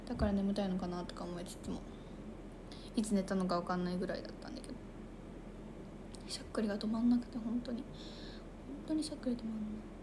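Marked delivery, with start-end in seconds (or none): 4.2-10.0 息だけでひそひそ囁く; hushed unvoiced whisper, as if in bed at night. (布団の中でこっそり囁く)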